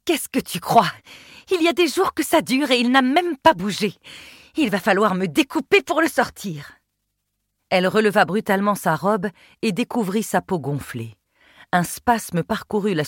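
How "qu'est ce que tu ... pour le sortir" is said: pained. A narrator's reading. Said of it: "déformés par la douleur".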